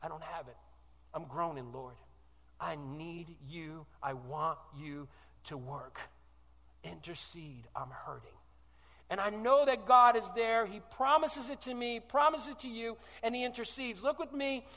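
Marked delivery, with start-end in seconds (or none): 0.0-8.4 quiet, slowing, pleading prayer; praying alone in struggle, hushed and pleading; retold desperate prayer. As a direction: sink into a soft, hurting plea. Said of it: "the thing I say is".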